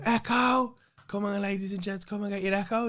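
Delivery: loud, high street cry to passers-by; paper seller shout. Retold or imitated. Playing an Echo seller for the cameras, loud high call. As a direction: hawk papers to the crowd at full voice. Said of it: "I was so loud".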